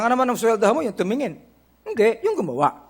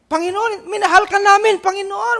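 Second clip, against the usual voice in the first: high, raised-voice mock plea; acted pleading; 10 semitones higher, 7 dB louder than usual. Preacher imitating rejected believers addressing the Lord, high pleading. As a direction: plead upward as if protesting to the Lord.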